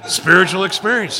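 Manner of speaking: loud, driving delivery; excited punchline. Real, not acted. Telling the room his car story's punchline, loud and excited.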